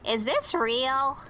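put-on slow, high little-kid wonder; a hint of an awed toddler. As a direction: GM voicing child squeezing a mushroom, high and slow. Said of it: "and is like".

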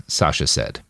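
usual speaking voice

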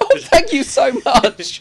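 high, drawn-out thanks through laughter; laughing thanks. Real, not acted. To co-host mocking the bad accent, laughing thanks. Teased about failed accent.

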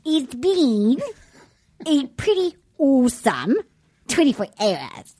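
imitating a New Zealand accent. Host repeating the clip line in a Kiwi accent. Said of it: "Let's make hear that part one more time".

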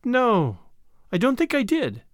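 high, pondering female character; high-voiced, unsure recollection; thoughtful heroine. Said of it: "Tuppence thought".

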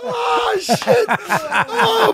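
high laughing yelp; wheezing laugh; laughing hard. Real, not acted. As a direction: crack up mid-sentence. Laughing at the bit.

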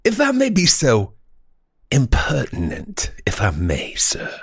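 halting, grandiose mock-genteel speaker; florid old-time orator. Host proffering a bold epigram, as a florid orator. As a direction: savor every pompous word with long pauses.

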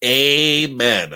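playful imitation of a friend's amen; Nathan amen. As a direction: echo Nathan's sign-off amen. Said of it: "I pull out a Nathan".

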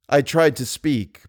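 plain reading voice

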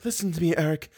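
high, soft, sighed appeal; sighing. A narrator's reading. Girl appeals to her captor, sighing. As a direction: sigh it out as a gentle plea. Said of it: "sighed the girl".

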